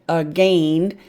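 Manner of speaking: "Again" sounded as a-GAIN with a long ay; long A reading. Shown as a demonstration. Teacher sounding out the spelling for students, long A. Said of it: "Long A, silent I".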